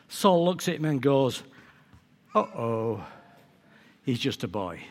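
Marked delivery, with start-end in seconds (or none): 2.3-4.8 low, soft acted letdown; dismayed aside. King sizes up young David, dismayed aside. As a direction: sink low and quiet in mock dismay. Saul's reaction on seeing David.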